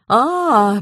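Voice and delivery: high, drawn-out "А". A narrator's reading. Woman recalls the artist named by police, drawling. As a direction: stretch the sound as recognition comes. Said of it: "протянула Зинаида Львовна".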